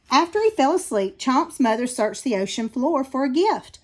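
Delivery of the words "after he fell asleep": usual speaking voice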